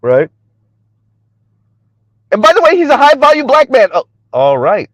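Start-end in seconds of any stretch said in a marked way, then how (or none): 2.3-3.9 high-pitched mock brag; mocking, high show-off voice; Kim Foster. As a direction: imitate a boastful woman, pitched up. Mocks Kim Foster's boasting.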